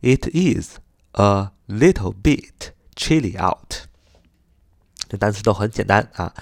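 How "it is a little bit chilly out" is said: slow, separated words. Shown as a demonstration. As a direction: read it slowly with gaps between words. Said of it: "一个单词一个单词地说". (一个单词一个单词地慢读)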